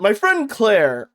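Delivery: usual speaking voice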